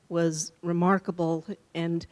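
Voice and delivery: ordinary talk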